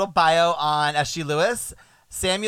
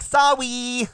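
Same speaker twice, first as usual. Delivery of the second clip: put-on baby voice; 9 semitones higher, 5 dB louder than usual. Shown as a demonstration. Co-host demonstrates how she says sorry, high babyish voice. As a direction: say "Sorry" like a little kid, as sawwy. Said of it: "In the voice of a baby".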